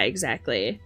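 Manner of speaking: ordinary talk, nothing marked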